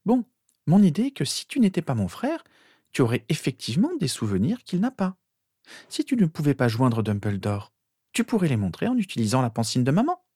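put-on high-pitched girl's voice; explaining sister. A narrator's reading. Sister explaining the Pensieve idea, matter-of-fact.

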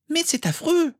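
put-on very high-pitched girlish outcry; shocked exclamation. A narrator's reading. Girl appalled at how Harry was treated, shocked exclamation. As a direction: cry out appalled, pitched right up. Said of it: "s'écria Rose".

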